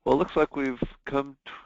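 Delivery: normal talking voice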